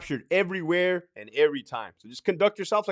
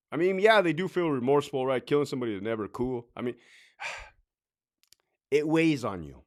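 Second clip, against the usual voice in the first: ordinary delivery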